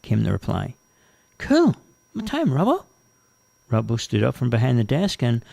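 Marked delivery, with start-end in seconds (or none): 1.4-2.8 high, keen new recruit; eager. Acted. Jane asks her new boss the start time, eager. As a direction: ask keenly, pitched right up.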